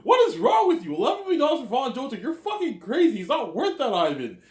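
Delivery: high, loud, rapid-fire tirade; mock outraged shouting. Berating Ivan over eleven million dollars, shouting high and outraged. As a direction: yell it in hammed-up disbelief.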